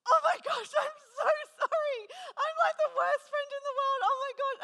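very high, loud, laughing apology; mortified apology. Retold or imitated. Apologising to the friend for missing her birthday, loud laughing squeal. I was so upset with myself.

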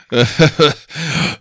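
a short hammed-up evil laugh; villain laugh. Game master laughs as the villain at the beaten heroes, hammed up. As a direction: cackle like a gloating wizard. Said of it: "Kallmann lacht".

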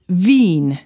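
"Wien" said veen, with a v; German pronunciation. Shown as a demonstration. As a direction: say "Wien" with v and a long ee. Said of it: "is pronounced E".

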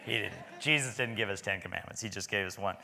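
ordinary talk, nothing marked

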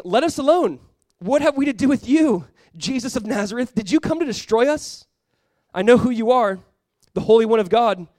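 loud, high cry of a demon-possessed man. A narrator's reading. Demon-possessed man challenging Jesus in the synagogue, crying out. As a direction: cry out in protest, pitched well up. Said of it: "he cried out, saying".

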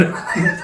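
breathy laugh. Real, not acted. Laughing at phone habits.

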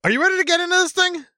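high, fast, hyped-up question; excited. Real, not acted. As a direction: pump up the audience. Hyped launch into the review.

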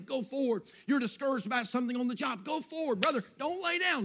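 high, driving pulpit exhortation, climbing at the end; exhorting preaching. Real, not acted. Preacher urges discouraged believers to go forward, fervent preaching. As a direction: urge them onward with rising fervour.

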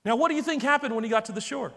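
normal talking voice

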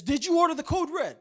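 high, loud demanding yell; courtroom shout. Retold or imitated. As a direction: hammer the witness with the question. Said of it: "and then he says".

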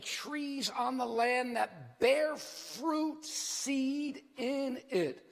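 slow, solemn Bible reading; scripture reading. Acted. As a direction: read the verse slowly and gravely.

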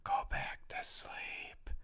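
slow, eerie whisper; ghostly whisper. Retold or imitated. Same ghost voice at a bunk bed nap, slow whisper. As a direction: breathe it out slowly like a ghost. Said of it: "hear that same voice go".

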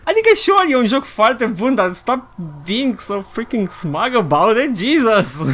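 high-pitched exasperated rant; worked-up, pleading complaint. Acted. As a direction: rant in fed-up disbelief, rising high. Mock rant at smug fans.

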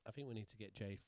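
ordinary talk, nothing marked

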